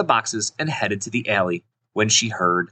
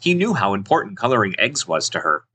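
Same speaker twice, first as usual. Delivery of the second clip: plain reading voice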